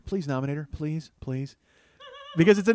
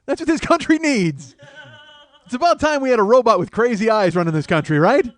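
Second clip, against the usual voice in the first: higher and louder, gushing zeal; mock fired-up voter; 6 semitones higher, 7 dB louder than usual. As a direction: play a voter cheering her on. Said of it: "people out there who are like".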